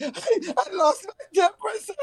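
high, slow, tearful little voice; a sorry schoolgirl owning up, near tears; tearful confession. Retold or imitated. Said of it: "and I'm like".

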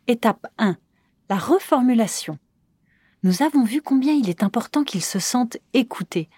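ordinary talk, nothing marked

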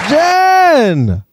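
loud, high shout of her name; excited call. Real, not acted. Calling the guest out.

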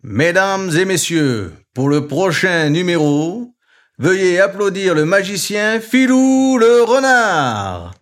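loud, slow circus announcer; ringmaster announcement. A narrator's reading. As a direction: roll it out grandly to the crowd. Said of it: "annonce Monsieur Loyal".

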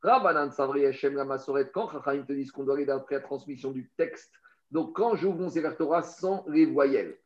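normal talking voice

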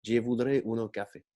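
put-on carnival Italian accent, every syllable detached; Ponti's fairground Italian accent. Old inspector Ponti answering the narrator, in a hammy Italian accent. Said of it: "Comme ça, lentement, avec un accent de foire".

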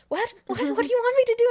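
freaking out in sudden panic; shaky panicked shriek of mock terror; panicked squeal. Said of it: "shaking and freaking out".

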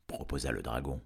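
ordinary delivery, nothing marked

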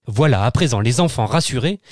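ordinary delivery, nothing marked

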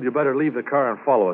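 ordinary talk, nothing marked

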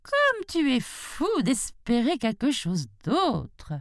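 a touch of scorn from the road; scornful road. Acted. The road speaks.

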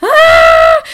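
very high, loud scream of pain. Retold or imitated. In the corridor in labour, loud high scream. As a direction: shriek as if in labour.

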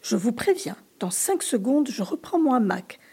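ordinary delivery, nothing marked

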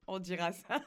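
words broken by laughter; giggling through it; laughing. Real, not acted. Still laughing.

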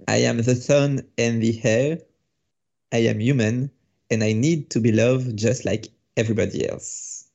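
slow, deliberate recitation of English lyrics; spoken song lyrics. Pretend. S1 recites the theme song lyrics for S0, slow spoken English. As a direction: recite the lyrics slowly, no tune. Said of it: "on peut te les faire en anglais sans l'air".

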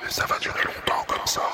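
hushed airy whisper; ASMR whisper. Acted. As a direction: whisper close to the mic.

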